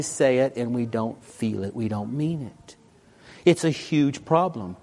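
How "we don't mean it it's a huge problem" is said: normal talking voice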